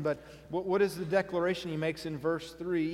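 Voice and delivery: ordinary talk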